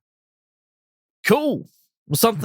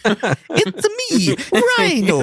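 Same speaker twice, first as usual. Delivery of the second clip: mock Rhino voice; much slower than usual. Jokes as Rhino character.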